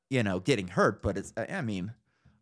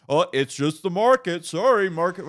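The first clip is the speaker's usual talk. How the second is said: mock whiny excuse, higher and louder; mocking the newcomer; 6 semitones higher, 5 dB louder than usual. Mocking the new guy's market excuse to his friend, sneering. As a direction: parrot the guy's lame excuse in a sneering put-on voice. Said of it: "and you're just gonna".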